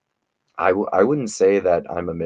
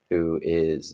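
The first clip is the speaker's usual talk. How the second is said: usual speaking voice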